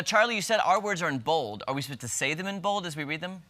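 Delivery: ordinary talk, nothing marked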